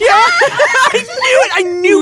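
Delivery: high squealing shouts with laughter; excited shriek. Acted. Friend squealing over the couple's news, shouting with laughter. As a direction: squeal with delight at the gossip. Friends hear of secret romance.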